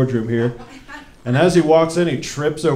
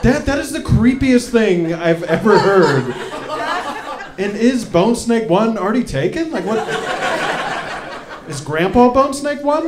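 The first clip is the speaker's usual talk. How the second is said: laughing disbelief; 9 semitones higher than usual. Retold or imitated. Mocking dad's creepy username.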